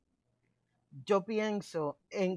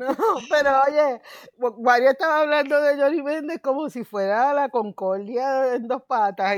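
higher and much louder, through laughter; loud, laughing; 5 semitones higher, 11 dB louder than usual. Real, not acted. Teasing the co-host over the politician, loud, laughing. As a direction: tease him loudly while laughing.

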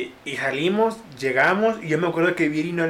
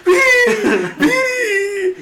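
much higher and louder, as if through a megaphone; yelling like a megaphone; 6 dB louder, much slower than usual. Retold or imitated. Ale calling Viri out through a megaphone, high blaring shout. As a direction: bellow her name like through a loudspeaker. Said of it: "así con una pinche bocina".

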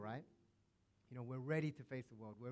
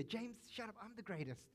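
put-on childish squabble; 8 semitones higher, 4 dB quieter than usual. Disciples bickering like children.

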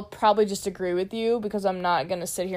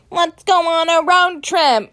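loud, high, drawn-out caricature; mock eager enthusiast; 8 semitones higher, 10 dB louder, slower than usual. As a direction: mimic someone annoyingly keen on adventure. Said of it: "I hate people who are like".

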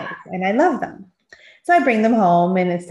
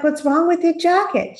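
puzzled mother; 9 semitones higher than usual. Retold or imitated. Mother retells questioning her daughter.

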